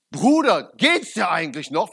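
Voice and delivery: loud, indignant retold rebuke; angry rebuke. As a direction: tell him off, fired up like Jesus in the temple. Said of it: "ich war so richtig zornig". (lauter, empörter Tadel)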